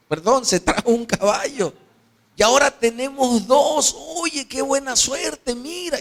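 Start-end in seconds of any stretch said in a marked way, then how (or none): 2.4-6.0 high, gleeful exclaiming; excited son. Acted. Son showing his father the two horses, excited. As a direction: marvel at the luck in a raised youthful voice. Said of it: "Y el muchacho".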